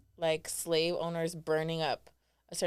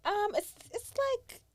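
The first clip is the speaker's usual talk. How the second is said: squeaky stalling. Acted. Playful high-pitched stalling.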